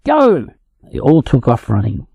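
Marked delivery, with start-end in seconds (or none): 0.0-0.5 high excited yell. A narrator's reading. Duckling shouting to start the race, high yell. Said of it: "Then Squudge yelled".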